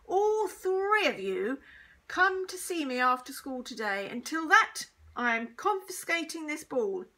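high, loud scolding orders; angry teacher. A narrator's reading. Teacher punishes three pupils, angrily. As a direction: lay down the punishment forcefully.

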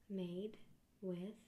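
ordinary delivery

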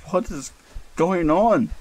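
high, panicked question; frightened. Acted. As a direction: let the fear break through. Hand trembling, panic rising.

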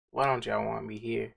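low, very soft wounded question; mock hurt. Told to leave, quietly hurt. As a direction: ask it small and quiet, as if rejected.

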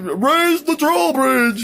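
very high, slow, drawn-out castle guard call; guard's call. Acted. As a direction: call it out like a herald on the wall. Guards raising the drawbridge.

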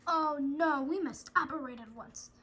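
ordinary delivery

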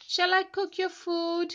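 high, loud hopeful ask; Danny hopefully asking Peg to help; eager dragon offer. Acted.

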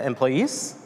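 ordinary talk, nothing marked

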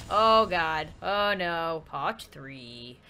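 plain reading voice

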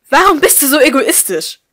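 loud, high furious accusation; angry outburst. A narrator's reading. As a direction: yell it at your daughter in rage. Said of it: "flammte Wut in ihrem Blick auf". (wütend und laut angeschrien)

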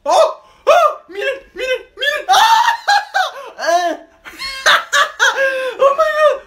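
high excited shrieks; screaming with delight; excited shouting. Real, not acted. Trick finally works.

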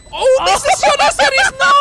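very high and loud; excited scream. Real, not acted. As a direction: scream in shocked disbelief. Missed shot, caster screams.

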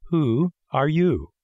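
slow snail questioning a visitor. A narrator's reading. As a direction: ask very slowly, as an unhurried snail.

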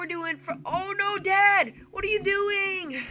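high mock-panicked kid voice; alarmed son. Son on Dad's crashing bike, high hammed-up alarm. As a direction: cry out like a kid whose ride goes wrong.

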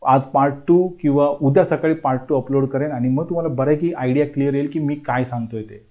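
usual speaking voice

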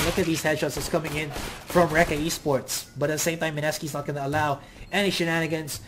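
usual speaking voice

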